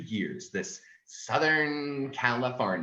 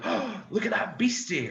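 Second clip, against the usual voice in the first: excited discovery; 8 semitones higher than usual. Shown as a demonstration. Acting kid finding bug.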